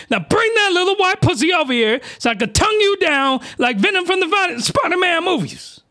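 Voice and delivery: high-pitched, emphatic character demand; Beast Mode voice. Acted. Acting Beast Mode's lusty demand.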